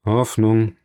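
ordinary delivery, nothing marked

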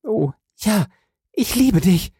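high, rapturous woman's voice; overjoyed, high-pitched outburst; ecstatic cry. A narrator's reading. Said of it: "einen Schrei ausstoßend".

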